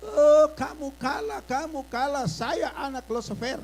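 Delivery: put-on loud, slow taunt of a possessed girl; a hammed-up demonic gloat. Preacher voicing the possessed girl, mock demonic taunt. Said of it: "Dia berteriak terus di kamar".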